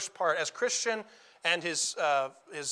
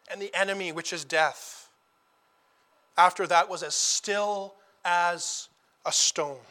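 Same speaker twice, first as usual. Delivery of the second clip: ordinary talk, nothing marked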